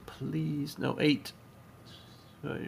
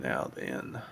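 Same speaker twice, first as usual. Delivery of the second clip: mad scientist; 11 semitones lower, 7 dB quieter than usual. Pretend. Low slow villain follow-up.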